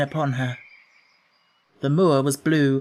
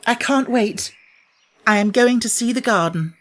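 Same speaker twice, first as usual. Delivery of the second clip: higher, bursting with excitement; can't hold it in another second; eager girl; 7 semitones higher, 3 dB louder than usual. Acted. Mary decides to run to the secret garden, higher and eager.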